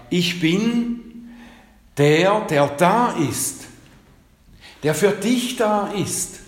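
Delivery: high, slow and swelling, God promising; fervent voice of God. Acted. Preacher voicing God to the congregation, fervent and rising. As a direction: lift it up as a heartfelt promise.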